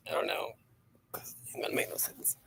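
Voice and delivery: whispered under breath; a private unvoiced aside. Real, not acted. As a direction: mutter it almost silently to yourself. Muttering to self.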